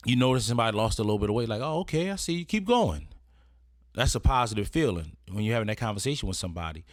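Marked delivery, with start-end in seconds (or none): 1.6-3.1 high-pitched playful approval; encouraging compliment. Acted. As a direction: cheer someone on, pitch climbing. Acted compliment on lost weight.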